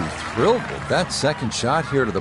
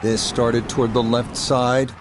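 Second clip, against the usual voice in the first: golf commentator. Acted. Live golf broadcast play-by-play.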